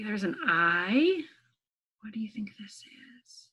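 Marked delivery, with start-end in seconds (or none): 2.0-3.5 hushed secret whisper. Acted. Guessing game suspense for kids.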